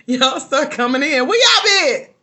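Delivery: high, very loud, laughing holler; mock outraged shout. Host teases viewers who join at the end, mock outraged shout.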